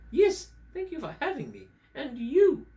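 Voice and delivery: high-pitched English puppet voice; English accent. Acted. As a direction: give polite thanks in a high English accent. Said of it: "I'm from England".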